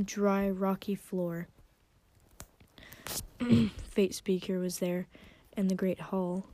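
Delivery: plain reading voice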